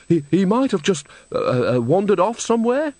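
high, stammering and flustered; a worried manager stammering high; nervous stammer. A narrator's reading. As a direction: stumble over the words, scared.

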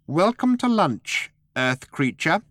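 high, slow little creature voice; a tiny host's drawn-out welcome, pitched high; small voice. A narrator's reading. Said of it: "A small voice said".

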